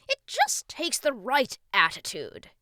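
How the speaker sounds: loud, high pep-talk king; encouraging king. A narrator's reading. King coaches the doubtful boy, loudly encouraging. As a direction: cheer him on with projection. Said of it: "King Shumiwami encouraged".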